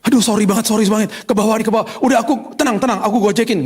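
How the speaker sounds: high, fluttery apologetic wife voice. Retold or imitated. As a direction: play the flustered wife begging pardon, pitched high. (istri panik minta maaf dengan suara tinggi)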